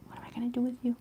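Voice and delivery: soft unvoiced whisper to the cat. Real, not acted. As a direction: breathe it out as a secret. Repeated as whisper.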